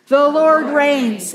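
slow, each word weighted and firm; a slow, declared call-and-response line; slow proclaimed refrain. Real, not acted. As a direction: lead the congregation in the words. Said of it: "Say it with me".

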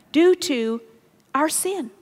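ordinary talk

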